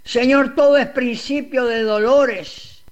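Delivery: ordinary talk, nothing marked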